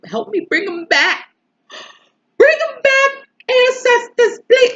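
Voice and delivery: high, loud pleading wail, voice breaking upward; desperate cry for him to come back; tearful plea. Retold or imitated. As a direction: beg the ancestors through tears. Begging ancestors while crying in rain.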